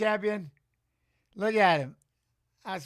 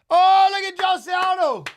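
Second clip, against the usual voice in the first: high, loud, drawn-out cry of joy; excited shout; 11 semitones higher, 11 dB louder than usual. Real, not acted. Streamer sees his idol on screen, shouting with real excitement. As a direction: yell it out as the hero appears. Fighter legend walks out live.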